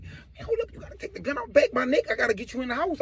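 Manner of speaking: soft, high careful request, acted; mildly anxious small voice; nervous pleading. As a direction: negotiate gently with a gun on you. Pleads with gun at his neck.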